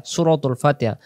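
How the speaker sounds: normal talking voice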